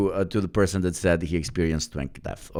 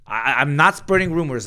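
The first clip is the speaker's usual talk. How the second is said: higher, louder protest; raised defensive insistence; 11 semitones higher, 6 dB louder than usual. Real, not acted. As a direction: insist you're not gossiping. Defends against a rumor charge.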